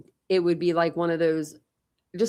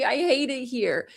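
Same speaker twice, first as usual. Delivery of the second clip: higher, slower mock whine; complaining mom; 6 semitones higher than usual. Mom complaining about new town, higher slow whine. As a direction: whine like an unhappy mother. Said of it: "She's like".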